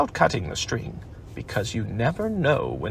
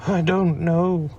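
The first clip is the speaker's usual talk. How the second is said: doleful; 5 semitones higher, 4 dB louder, slower than usual. Acted. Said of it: "said Pooh rather dolefully".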